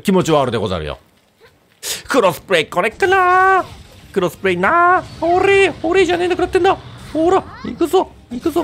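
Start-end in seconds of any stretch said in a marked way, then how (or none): 2.1-8.6 high falsetto voice. Pretend. Playful high voice while playing.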